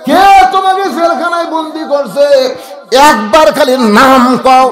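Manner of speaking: put-on boy yelling outraged at the jailer; child shouting. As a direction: shout it like a furious little boy demanding a name. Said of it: "ছেলে চিল্লায় বলে".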